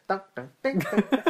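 high sung guitar plinks; mock guitar notes. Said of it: "and so it's like".

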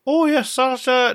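put-on breathy old Hollywood starlet, drawn out; 1940s female voice. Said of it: "the female version of the nineteen forties voice".

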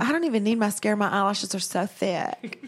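put-on Southern girl bragging lightly; country girl. As a girl boasting about her lashes, country accent. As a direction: give it a country-girl twang. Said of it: "one of those girls that's just like".

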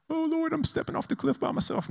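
very high, squeaky wail; hammed-up frightened falsetto; mock terror. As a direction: wail in comic dread while stepping off. Parody of terrified lone jumper.

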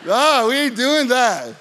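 loud, laughing "nah, man", drawn out slowly; playful pushback to son. Retold or imitated. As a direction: tease him loudly while laughing, refusing his words. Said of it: "I'll be like".

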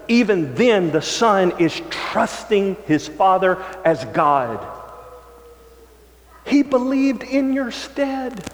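high-pitched, drawn-out sermon climax; impassioned preaching. Real, not acted.

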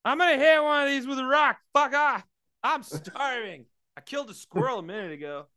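a famished man itching to hunt; starving hunter. Pretend. Fisherman to his crew, loud starving woodsman.

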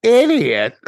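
high, mock-outraged quote breaking into laughter; laughing disbelief. Actor scoffing at the accusation, retold high through laughter. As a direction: crack up while acting out outrage.

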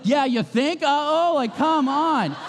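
high, mocking "uh-oh?" that trails off slowly; dad sarcastically answering his daughter's uh-oh, high mocking tone; sarcastic retort. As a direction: drip sarcasm at the kid. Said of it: "And I wanna be like".

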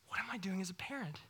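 whispered, panicked self-question; anxious whisper. Retold or imitated. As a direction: breathe it like a worried parent talking to themself. Parent's anxious inner voice.

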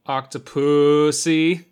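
put-on slow, over-careful drunk word, the long vowel dragged out; drunk careful enunciation. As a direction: say Octopussy like a drunk trying hard to pronounce it. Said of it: "He enunciated the word carefully, but drowned in the long vowel".

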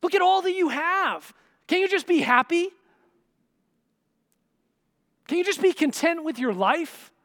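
high, loud pleading with rising questions; exasperated cajoling. Acted. Husband urging his wife to enjoy the feast, exasperated cajoling. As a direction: badger her to cheer up, pitch pushed high. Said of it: "He kinda wants her to just enjoy the feast".

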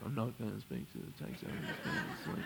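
hushed mock protest; muttered refusal. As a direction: mutter it under your breath, half laughing. Mimics his own muttered refusal over prayer.

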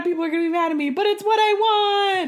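high, loud, fretful inner voice; anxious inner voice. Retold or imitated. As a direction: wring your hands out loud. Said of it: "being like".

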